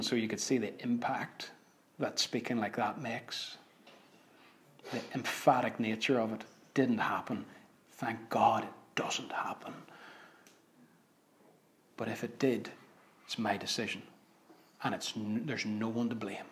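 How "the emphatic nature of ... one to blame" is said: hushed. Real, not acted. Soft lowered aside.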